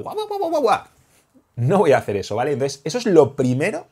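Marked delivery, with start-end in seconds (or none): 0.0-0.8 very high yapping barks; shrill hammed-up dog barks; excited mock barking. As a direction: yap like a dog chasing the bad guy. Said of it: "Ahora persigue al malo".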